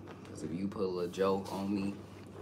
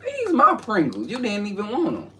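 sassy mock voice; 14 semitones higher, 10 dB louder than usual. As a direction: tease him in a squeaky diva voice. Mocking friend over his Pringles.

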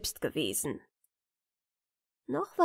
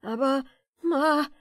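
plain reading voice

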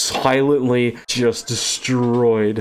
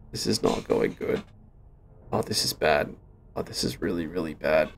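scared whisper; 6 semitones lower, 7 dB quieter than usual. Real, not acted. Alone in the dark, fearing discovery.

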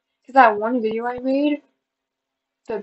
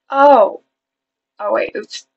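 usual speaking voice

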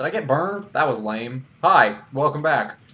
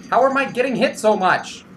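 ordinary delivery

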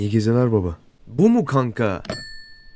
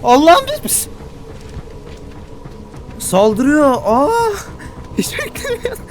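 terrified crying shout; 13 semitones higher, 4 dB louder than usual. Real, not acted. Monster attacks him.

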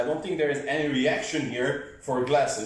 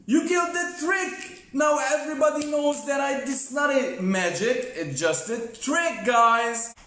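high-pitched mock dismay; playful outrage; 12 semitones higher than usual. Real, not acted. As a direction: complain that the trick got ruined. Trick ruined by son.

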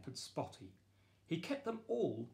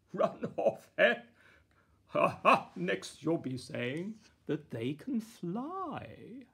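chuckling king; 5 semitones higher, 8 dB louder than usual. Acted. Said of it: "The king chuckled".

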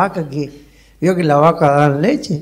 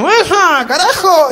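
mock outburst; 12 semitones higher, slower than usual. Comic tale, Mirta Legrand exclaims in high mock outrage. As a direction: blurt it out like a shocked diva. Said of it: "La Mirta Legrand dijo".

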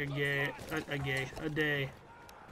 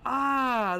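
loud, high, panicked shout; high, loud shout of dismay; 11 semitones higher, 7 dB louder, much slower than usual. Real, not acted. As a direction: yell it as the enemy gets you. Surprised by an enemy and killed.

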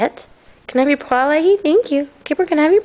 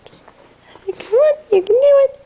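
encouraging dog-talk; 11 semitones higher, slower than usual. Real, not acted. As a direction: urge the dog to bark. Coaxing dog to speak.

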